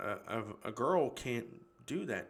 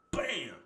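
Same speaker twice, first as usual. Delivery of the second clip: sudden loud, high "bam"; punchy exclaimed impact noise; punch sound effect; 4 semitones higher, 5 dB louder than usual. Retold or imitated. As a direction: land it like a punch. Imitates the punch landing.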